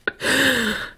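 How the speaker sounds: sudden high helpless laugh; laughing fit at the word porker; burst of laughter. Real, not acted. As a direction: crack up mid-sentence. Breaks up reading old verdict.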